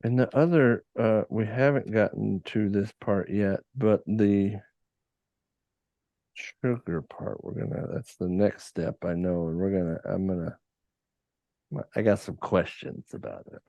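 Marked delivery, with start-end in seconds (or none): none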